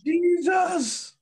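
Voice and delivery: high shrill cry of "Jesus!"; mock shriek. Repeats the woman's cry.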